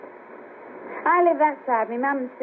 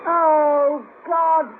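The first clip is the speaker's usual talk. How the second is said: higher, louder groan of dismay; disgusted dismay; 4 semitones higher, 4 dB louder than usual. Acted. Woman just hit by bird droppings, disgusted dismay. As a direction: cry out at a nasty mess.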